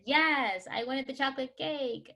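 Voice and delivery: put-on high-pitched pouty kid voice; role-played little kid answering; child's reply. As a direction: imitate a child owning up in a small, high voice. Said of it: "And they said".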